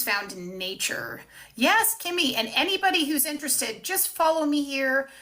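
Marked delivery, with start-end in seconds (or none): 1.5-5.1 high, bright call to a viewer; excited greeting. Real, not acted. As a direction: greet her warmly and loud.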